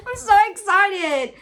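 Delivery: high, loud, thrilled squeal; real excitement. Real, not acted. Vlogger overjoyed at friend's bookmark, excited squeal. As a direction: squeal it with delight.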